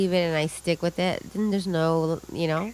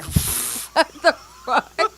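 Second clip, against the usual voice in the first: incredulous laugh; 7 semitones higher, much slower than usual. Retold or imitated. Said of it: "And I'm like".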